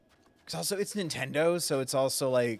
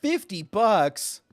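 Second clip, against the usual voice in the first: incredulous; 6 semitones higher, 8 dB louder, much slower than usual. Real, not acted. Outraged at shop price.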